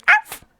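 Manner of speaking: squeaky puppy arf; high yappy bark. Pretend. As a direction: yap like a tiny dog. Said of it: "Is it better if I'm like".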